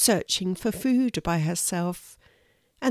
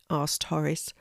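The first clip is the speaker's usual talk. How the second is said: plain reading voice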